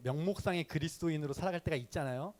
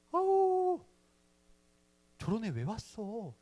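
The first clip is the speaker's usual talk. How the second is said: much higher disgusted squeal; mocking church girls; 7 semitones higher, 4 dB louder, much slower than usual. Church girls recoiling at the newcomer, high scornful squeal. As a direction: sneer like a girl repelled by him.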